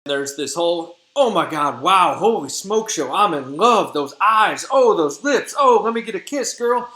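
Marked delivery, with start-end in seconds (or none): 1.1-6.9 loud, high, hammed-up swooning; mock rapture, climbing higher and louder; gushing infatuation. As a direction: go over the top as a lovestruck suitor. Demetrius wakes madly in love, acted.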